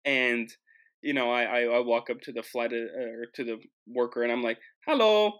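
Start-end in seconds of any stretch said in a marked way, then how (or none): 4.9-5.3 high, loud German "Hello"; German-style greeting. Retold or imitated. Traveler greeting airport worker, high German-accented greeting. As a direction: sing out a German hallo. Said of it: "confused that I said it like that".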